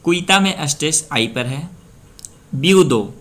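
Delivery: ordinary talk, nothing marked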